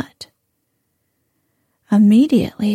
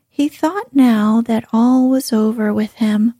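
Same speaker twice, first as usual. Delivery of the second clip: usual speaking voice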